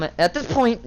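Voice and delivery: ordinary talk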